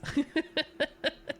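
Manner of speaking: a short high-pitched giggle. Real, not acted. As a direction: giggle at your own joke. Laughs at own joke.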